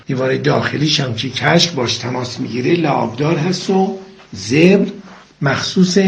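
normal talking voice

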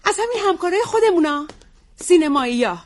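ordinary talk

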